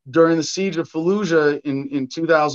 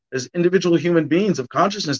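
normal talking voice

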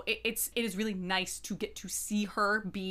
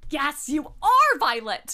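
excited cheer; 4 semitones higher, 8 dB louder, slower than usual. Real, not acted. Cheering the heroine's declaration.

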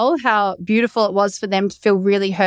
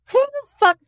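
high-pitched incredulous retort; mock-incredulous, pitched well up; amused disbelief; 8 semitones higher than usual. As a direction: imitate men baffled and tickled by an answer. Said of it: "these two sixty-five-year young men are laughing".

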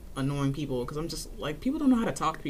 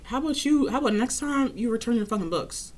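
higher, sassy snap; sassy retort; 7 semitones higher than usual. Retold or imitated. Clerk retorting to patron about returning books, mock sassy. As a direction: lightly fling it back at her. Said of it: "So I'm just like".

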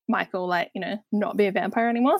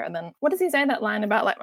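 ordinary talk, nothing marked

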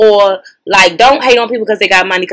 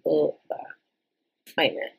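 ordinary talk, nothing marked

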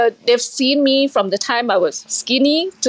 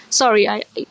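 emotional. Real, not acted. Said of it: "It is emotional".

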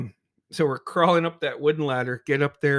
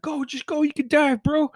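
mock screaming encouragement; 12 semitones higher than usual. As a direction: imitate a friend egging someone on. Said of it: "Narbona's screaming".

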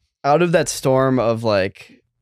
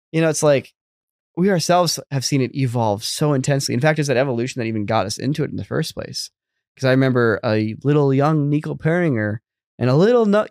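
usual speaking voice

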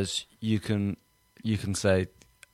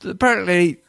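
normal talking voice

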